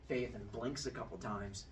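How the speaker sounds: ordinary talk, nothing marked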